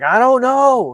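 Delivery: high, loud mock pleading; imitated desperate plea. Recapping a man tortured for a key, imitated desperate plea.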